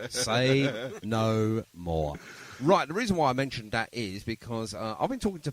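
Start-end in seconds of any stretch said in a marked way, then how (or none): 0.1-2.2 slow, drawn-out knowing wink with a laugh; knowing catchphrase. Pretend. Host teasing guest about famous names, slow knowing drawl. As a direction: stretch it out like a nudge-nudge joke. Host jokes about name-dropping Brian Epstein.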